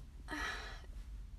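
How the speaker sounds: soft breathy sigh; whispered sigh. Real, not acted. Vlogger sighing softly mid-thought. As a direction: let out a quiet sigh.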